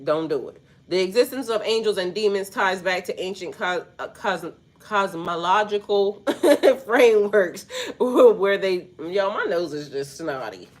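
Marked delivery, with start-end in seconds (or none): 6.3-6.8 short, loud burst of laughter; real laugh. Real, not acted. Reader losing it over a snotty nose, real laugh. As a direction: break into a quick laugh. Breaks up while reading.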